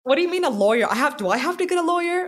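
high, loud flustered protest; retold panic. Retold panic about needing a lawyer.

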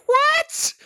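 very high squeaky what; startled what. Retold or imitated. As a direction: yelp it out in shock. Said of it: "all the Ians are like".

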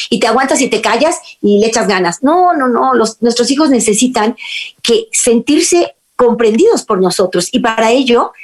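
ordinary talk, nothing marked

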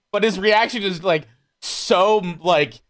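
ordinary delivery

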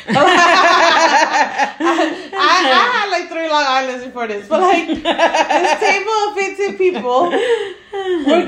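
tipsy, giggling through the words. Real, not acted. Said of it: "Sorry, I'm a little tipsy".

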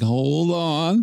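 high, drawn-out plea to wait; alarmed restraint. Retold or imitated. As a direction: stretch it out high, stopping someone mid-move. Restraining her in the story.

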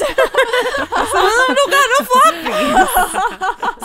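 high, slow, laughing whine. Real, not acted. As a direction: giggle through the words. Laughing mock complaint.

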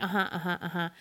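low, soft, absent-minded uh-huhs; distracted agreement. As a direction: imitate someone only half listening. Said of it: "wasn't really paying that much attention".

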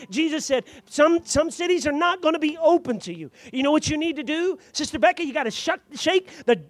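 loud, high preacher's exhortation; preacher urging the young adults, loud exhortation; full-force preaching. Acted. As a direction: drive it home to the congregation.

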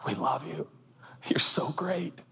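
soft unvoiced whisper; whispered reassurance. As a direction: breathe it as comfort. Acted congregation reassuring.

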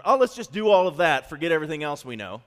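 loud, high, rushed outburst; over-eager. Acted. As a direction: blurt it out as a mock-eager recruit. Mimics an over-eager reaction.

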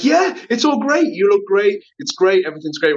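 high, upbeat gushing; gushing American. Retold or imitated. Mocking the upbeat American mentality in a high, gushing voice. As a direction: oversell the positivity. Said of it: "it's like".

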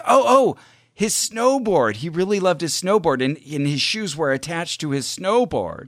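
acted burst of recognition, high at first; excited realization. Woman tells the psychic about his snowboard, excited. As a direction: play her sudden eureka, pitch easing down.

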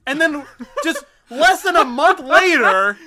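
laughing. Real, not acted. Laughing while telling the story.

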